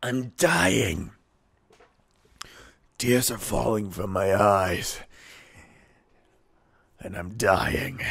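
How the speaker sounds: slow, choked voice after coughing; choking. Real, not acted. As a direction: speak slowly, still choking on coffee. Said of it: "I've swallowed coffee".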